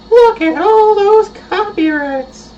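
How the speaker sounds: high, slow cartoonish voice; high silly voice. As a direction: squeak it out like a cartoon. Repeats line in put-on voice.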